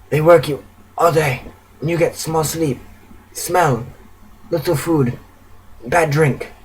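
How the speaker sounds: soft, frail, halting list; quiet, drained suffering; crying dryly. A narrator's reading. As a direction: recount it weakly in short broken phrases. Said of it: "starts crying dryly".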